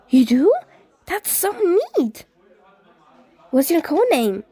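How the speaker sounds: sudden thrilled jump in pitch; excited. Acted. Ghost excited, eyes widened.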